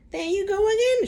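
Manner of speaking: put-on high, loud old hag cry; crying out Grandma. A narrator's reading. Grandma scolds George for arguing. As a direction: throw it at him like a cranky grandmother. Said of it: "she cried".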